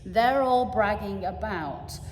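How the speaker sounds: expressive poem recital to an audience; heartfelt reading of a short poem. Acted. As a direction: read the poem with feeling, building the story. Reads a poem aloud.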